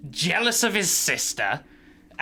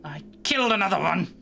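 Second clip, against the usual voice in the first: irate god; 7 semitones higher than usual. Acted. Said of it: "He's like".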